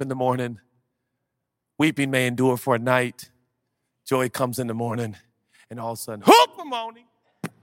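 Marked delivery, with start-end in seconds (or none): 6.2-7.1 joyful exclamation. Acted. Exultant coined word shout.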